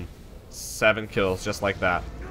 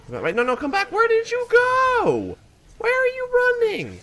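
exasperated high yell. Real, not acted. Player yelling after a fleeing enemy, exasperated high wail. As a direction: call after them in a high, stretched cry.

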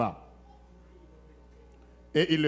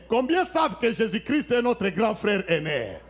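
usual speaking voice

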